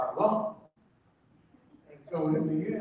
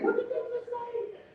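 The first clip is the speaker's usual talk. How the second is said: disbelief; 7 dB quieter than usual. Retold or imitated. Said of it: "I was like".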